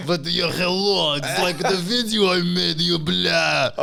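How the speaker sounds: put-on high, slowed caricature Russian accent; exaggerated imitation Russian accent; mock Russian accent. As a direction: mimic an over-the-top Russian accent. Mimicking the exaggerated accented delivery.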